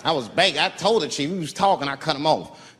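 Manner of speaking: usual speaking voice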